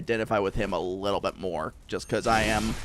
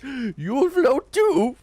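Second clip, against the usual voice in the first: Pennywise; 13 semitones higher, 4 dB louder, much slower than usual. Acted. Streamer shows off his Pennywise, high and slow. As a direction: gasp then a high, slow, creepy clown drawl. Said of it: "I can do a half-decent Pennywise".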